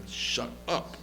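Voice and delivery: whispered shut up; hushed whisper. Retold or imitated. Elazar telling Golda to shut up, whispered. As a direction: hiss it under breath. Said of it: "He said".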